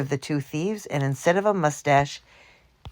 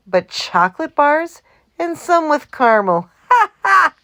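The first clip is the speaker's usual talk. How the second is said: laughing; 10 semitones higher, 7 dB louder than usual. A narrator's reading. Mac laughing roll call.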